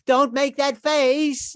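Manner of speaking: high, slow, drawn-out whiny plea; mock wife. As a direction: stretch it out high like a nagging wife. Comic opener mimicking wife.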